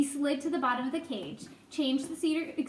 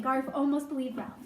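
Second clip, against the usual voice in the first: plain reading voice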